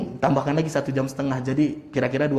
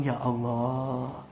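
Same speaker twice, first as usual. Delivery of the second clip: rueful reflection; 4 semitones lower, 7 dB quieter, much slower than usual. Retold or imitated. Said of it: "saya langsung merenung".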